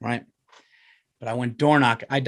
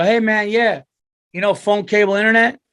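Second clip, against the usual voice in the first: pushy salesman; 8 semitones higher, 6 dB louder than usual. Retold or imitated. Mock salesman pitch at door.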